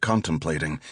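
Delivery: usual speaking voice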